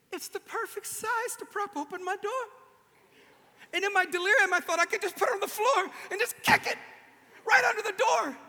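high, loud, laughing eureka; giddy with a crazy idea; delirious excitement. Retold or imitated. Overheated in a hotel room, laughing, high and loud. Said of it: "And then I went".

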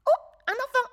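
high, loud small rabbit voice; rabbit character. A narrator's reading. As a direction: play the surprised rabbit. Rabbit voice in story.

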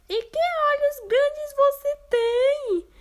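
put-on amazed girl. Girl marvelling at grandma's arms.

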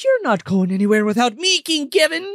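put-on queenly voice, rising high; imperious fairy queen. Fairy queen insists on joining King Kevin, imperious imitation. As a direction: declare it as a bossy fairy queen. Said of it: "the Fairy Queen shows up".

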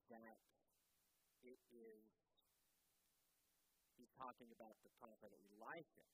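normal talking voice

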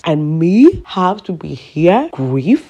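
loud, slow, incredulous question; indignant disbelief. Real, not acted. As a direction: stretch it out in outraged disbelief. Indignant rhetorical question about grieving.

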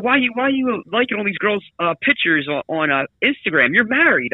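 lightly acted disbelieving scold; mock scolding. Chiding a married friend over liking pictures, mock scolding.

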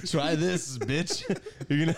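mock pitch through laughter; laughing through a cheeky offer. Mock pie pitch while laughing.

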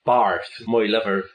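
slow West Country bumpkin drawl; West Country accent. Acted. Bath local answering, slow West Country voice. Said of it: "gain a West Country voice".

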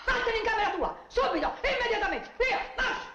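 high, sharp scolding order; high angry mother's command. Acted. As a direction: send her to her room, furious.

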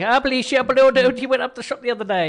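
high, loud sing-song Welsh lilt, mangled words; mock Welsh sing-song. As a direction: bounce it up high like a Welsh local rattling on. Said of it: "It's like".

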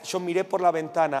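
normal talking voice

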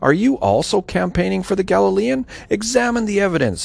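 high-pitched challenging retort; narrated interruption, pitched well up; cutting in. A narrator's reading. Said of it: "But they cut him off".

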